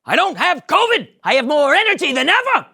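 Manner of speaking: very high, loud angry father; yelling Frank. Acted. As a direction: shout over your wife. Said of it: "grabs the phone and yells".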